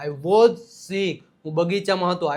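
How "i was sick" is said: slow teaching demo. Shown as a demonstration. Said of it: "હું બીમાર હતો".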